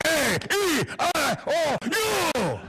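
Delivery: grunted vowels. Retold or imitated. Uncle doing vowels.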